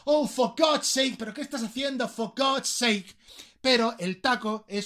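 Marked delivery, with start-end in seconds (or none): 0.1-3.1 raised pitch, dragged-out complaint; acted exasperation. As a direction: complain as if fed up with someone. Acts exasperation at someone's doing. (exasperación actuada, tono agudo)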